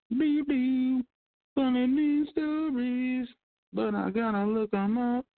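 high put-on voice, slowed on funny news stories; goofy host voice for the news bit; silly voice. Introducing his news segment to listeners in a high goofy voice.

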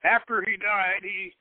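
ordinary delivery

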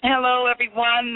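normal talking voice

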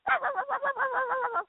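imitated dog noises ending in a howl; high imitated dog howl; dog sounds. Shown as a demonstration. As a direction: yap rapidly like a dog, then howl. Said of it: "learned how to do dog sounds".

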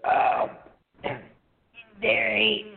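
lightly mock his rural grandmother; imitating grandma. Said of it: "this is how she talked".

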